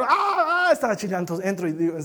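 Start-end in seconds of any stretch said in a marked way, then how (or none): 0.0-0.7 high mock squeal of a little girl; long high wail, playfully imitating a child; imitated child's shriek. Father imitating his daughter stuck in a costume, mock shriek. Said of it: "Cuando empieza a chillar".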